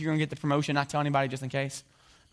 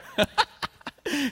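high laugh; 13 semitones higher than usual. Real, not acted.

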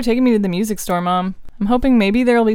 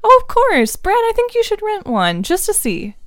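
mom voice; 11 semitones higher, 3 dB louder than usual. Acted. Mom voice returns.

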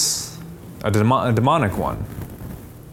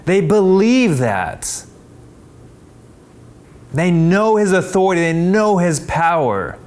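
preaching at full force; 7 semitones higher, slower than usual. Acted. Pastor drives home to the congregation that the demons know, preaching hard. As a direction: hammer each line home from the pulpit.

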